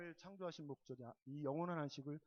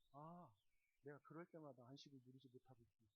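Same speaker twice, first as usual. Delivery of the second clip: soft, lowered voice, as if thinking aloud; quiet self-realization; 3 semitones lower, 14 dB quieter than usual. Retold or imitated. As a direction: murmur it to yourself as it dawns on you. (혼잣말하듯 나직이 깨닫는 말투)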